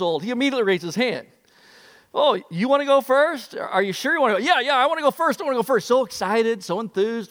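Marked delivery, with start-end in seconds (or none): 2.1-4.4 upbeat camp counselor voice, higher; counselor asking. Retold or imitated. 4.4-5.9 put-on higher, quick eager boy; eager kid. Eager boy voiced in story.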